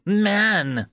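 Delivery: high, bitter outburst; spat in contempt. A narrator's reading. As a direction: spit the word out with disgust. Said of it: "it spat".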